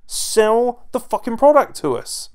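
loud, high, slow and emphatic; exasperated demand. Real, not acted. Fan ordering commentators to sell the product, exasperated.

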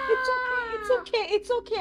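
tearful comforting. Acted. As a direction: soothe the child through tears. Mother comforting scared child.

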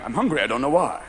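low cop voice, suddenly stoned; puzzled cop. Acted. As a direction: say it deep, as a bewildered policeman. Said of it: "suddenly he's going".

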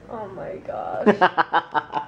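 laughing. Real, not acted. Reacting to joke, laughing.

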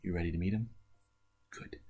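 normal talking voice

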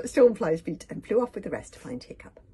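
usual speaking voice

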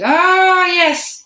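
high, loud sceptical "Oh, yes"; drawn-out sarcastic doubt; disbelieving. A narrator's reading. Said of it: "looking strangely at me".

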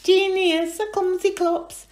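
high, loud teasing sing-song; high loud sing-song taunt; sing-song chuckle. Acted. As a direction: tease her in a sing-song. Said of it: "chuckled in a sing-song voice".

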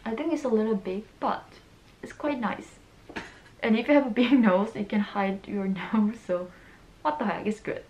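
speaking with mouth covered; through a face mask. Real, not acted.

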